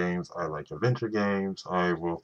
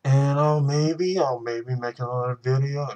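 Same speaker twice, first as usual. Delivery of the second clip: teasing maybe; 6 semitones higher, 5 dB louder than usual. Real, not acted. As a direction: tease the maybe out.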